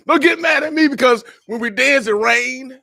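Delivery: loud, high-pitched cry to the crowd; exuberant call-out. Real, not acted. Laughs, then calls it out again.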